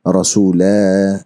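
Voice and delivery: रसूला drawn out very slowly; Arabic recitation of रसूला. Shown as a demonstration. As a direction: recite रसूला at a very slow pace. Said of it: "तो इसको हम इस तरह पढ़ेंगे".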